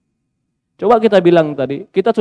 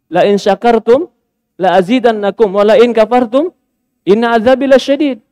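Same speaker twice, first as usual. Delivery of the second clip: louder, measured Arabic recitation; Quran recitation; 4 semitones higher, 4 dB louder than usual. Real, not acted. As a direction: recite the verse with reverence.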